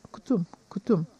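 ordinary delivery

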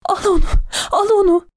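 very high, anguished plea with a cry in it; dying shout. A narrator's reading. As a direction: beg with a last agonised cry. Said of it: "tekrar bağırdı".

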